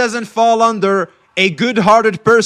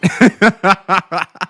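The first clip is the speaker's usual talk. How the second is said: laughter; 4 dB louder than usual. Real, not acted. Streamer amused by a chatter's comment, bursting into laughter.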